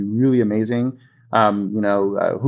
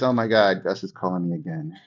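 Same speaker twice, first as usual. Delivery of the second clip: weary, lower, softer groan of an annoyed friend; annoyed contact; 6 semitones lower, 5 dB quieter than usual. Retold or imitated. Friend dreading the agent's call, weary groan. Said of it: "You don't want them to see you as".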